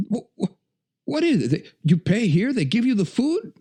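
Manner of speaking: stammering, high-pitched imitation of disbelief; mock amazement. Imitating friend amazed that they give you food, incredulous. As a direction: play the dumbfounded friend, voice way up.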